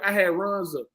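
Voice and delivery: ordinary talk, nothing marked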